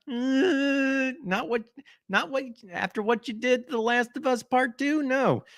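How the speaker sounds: high, whiny fake crying; hammed-up wail; mock sob. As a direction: pretend to weep over Part Two. Mock crying at gamer reaction.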